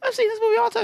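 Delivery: very high squealed indignation; hammed-up shrieky protest at normal volume; mock pissed-off protest. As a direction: imitate an upset victim, high-pitched. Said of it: "she was pissed".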